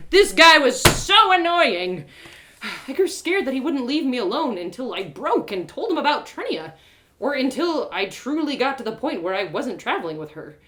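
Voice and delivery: plain reading voice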